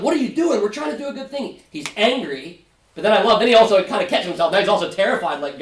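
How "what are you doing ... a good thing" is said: high, pleading complaint to God; lightly voiced David pleading upward; David complaining to God. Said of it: "And he's like".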